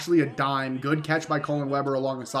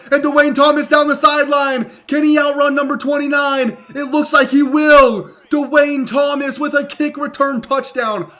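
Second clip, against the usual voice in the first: shouting higher and louder as he breaks free; excited touchdown call; 6 semitones higher, 6 dB louder than usual. Real, not acted. Gamer calling his returner's touchdown run, excited shouting. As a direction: call it like a live announcer losing it.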